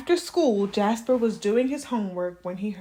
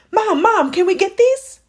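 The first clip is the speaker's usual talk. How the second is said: put-on high, loud kid begging; child pleading with Mom; 10 semitones higher, 10 dB louder than usual. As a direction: beg like an eager little boy. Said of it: "Jasper pleaded".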